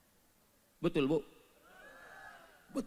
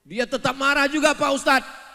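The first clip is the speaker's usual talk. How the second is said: high, loud protest; mock complaint; 11 semitones higher, 12 dB louder than usual. Husband loudly complaining to the preacher about his wife.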